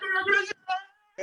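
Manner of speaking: high, pushing the crowd harder; hyped crowd call. Real, not acted. Host repeats call louder in pitch.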